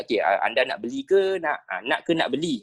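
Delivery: polite, measured question to a customer; correct customer intonation. Shown as a demonstration. Teacher shows how to ask a customer properly, polite intonation. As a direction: ask it gently in your ordinary register. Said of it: "intonasi suara yang betul, kita tanya".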